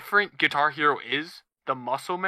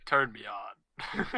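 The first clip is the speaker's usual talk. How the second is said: imitating a robotic read, very slow, lower; 8 semitones lower, 6 dB quieter, much slower than usual. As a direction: drag it out flat like a robot. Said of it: "read it like a robot".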